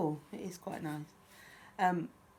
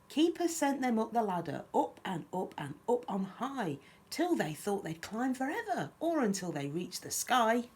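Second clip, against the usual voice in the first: lively, lilting picture-book reading; children's story narration; 5 semitones higher, 4 dB louder than usual. A narrator's reading. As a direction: read a rhyme aloud to little ones. Reads picture book aloud.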